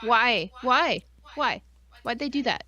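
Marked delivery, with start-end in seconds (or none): none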